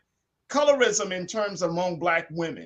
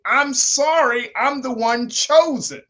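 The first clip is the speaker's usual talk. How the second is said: loud, higher, emphatic quoted apology; mock apology; 6 semitones higher, 6 dB louder than usual. Host quoting an actress's apology to dark-skinned actresses, emphatically imitated. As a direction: proclaim the retold apology, drawing out "sorry".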